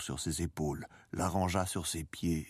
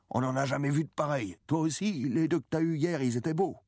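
higher-pitched, lively voice of the patron; admiring café owner; 9 semitones higher, 3 dB louder than usual. A narrator's reading. Café owner praising the fish to the boy, admiring. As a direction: marvel at the catch, pitched up.

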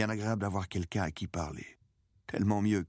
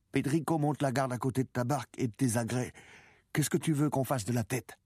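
put-on higher-pitched boy reporting news; practical young boy; 7 semitones higher than usual. A narrator's reading. Boy updating the old man about the boat, practical. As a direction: report and ask in a raised boyish pitch.

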